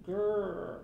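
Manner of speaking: mock troll growl.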